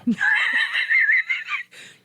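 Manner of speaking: high, shrieking burst of laughter; loud laugh. Real, not acted. Co-host after her own annoying impression, high loud laugh. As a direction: cackle loudly at your own joke.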